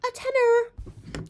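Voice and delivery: very high, slow squeal; squealed repeat. Acted. Boasting of the bargain dress price, squealed.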